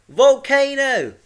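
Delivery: slow, high, full-volume yell; drawn-out shout of alarm. A narrator's reading. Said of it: "yelled her husband".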